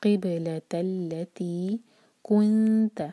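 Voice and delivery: slow chant, echoing बा; Quran recitation. Shown as a demonstration. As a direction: recite with a bouncing बा. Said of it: "बा पर क़लक़ला".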